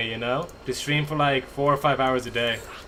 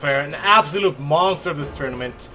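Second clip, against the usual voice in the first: hype announcer; 4 dB louder than usual. Acted. Player introduction and bet.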